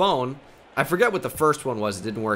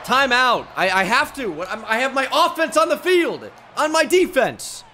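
high, incredulous protest; exasperated yelling; 9 semitones higher than usual. Real, not acted. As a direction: call timeout in disbelief, voice pitched up. Panicked timeout over wrong lineup.

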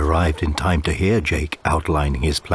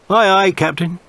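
put-on higher, louder cabin boy reply; shouted; 12 semitones higher, 4 dB louder than usual. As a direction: call up cheerfully to the captain. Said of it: "shouted Tom".

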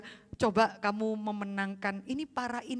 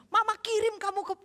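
higher, louder empty threat of a nagging mom; mock mother threat; 9 semitones higher, 4 dB louder than usual. Mother threatening her child, a mock shrill threat. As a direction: scold the kid with a threat you won't keep. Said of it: "Saudara tidak bisa bilang".

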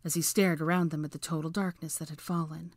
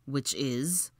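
curt, lower question; impatient; 3 semitones lower than usual. A narrator's reading. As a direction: prod impatiently for the answer. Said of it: "Draco inquired impatiently".

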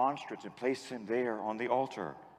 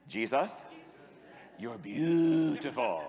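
put-on slow, laughing teen mumble; teenage way; slower than usual. Shown as a demonstration. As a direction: say it like a shy teenager. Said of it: "say it the teenage way".

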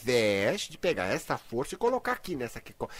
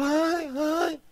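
mock sigh of the coward; 11 semitones higher than usual. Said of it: "Ela tá aí dentro".

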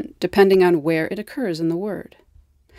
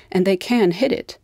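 ordinary talk, nothing marked

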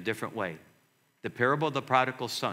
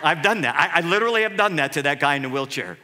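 ordinary talk, nothing marked

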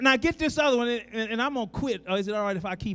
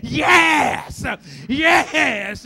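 a grandmother's loud excited yes; 3 semitones higher, 6 dB louder, much slower than usual. Retold or imitated. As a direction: let it burst out of you. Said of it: "grandmother got up and she said".

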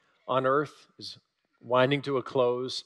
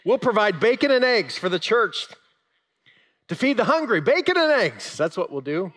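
high, loud, chirpy hen voice; eager hen; 8 semitones higher, 6 dB louder than usual. Acted. The hen offering bacon and eggs, high and loud. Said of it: "The hen says".

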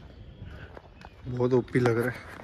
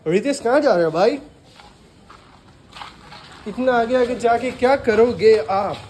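calling out; 6 semitones higher, 6 dB louder than usual. Real, not acted. Calling after friend walking ahead.

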